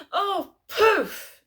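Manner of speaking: high, loud mock-shocked "Oh, poof"; a hammed-up, outraged old mum; shocked gasping exclamation. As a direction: squeal it like mum scandalised on the phone. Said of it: "She went".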